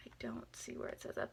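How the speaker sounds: low whispered aside; puzzled whisper to herself. Real, not acted. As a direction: whisper it under your breath. Searching the box label.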